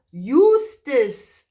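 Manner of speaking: loud, drawn-out hoot of the name. Acted. Owl shouting name.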